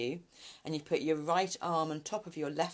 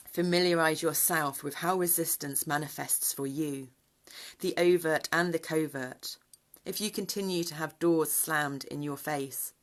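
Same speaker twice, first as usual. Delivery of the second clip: reading aloud; 2 semitones higher, 4 dB louder than usual. Acted. Reads guidance text aloud.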